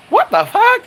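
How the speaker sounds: high falsetto squeal of disbelief; mock disbelief. Reacting to being called gorgeous, high-pitched mock disbelief. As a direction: squeak it out, incredulous.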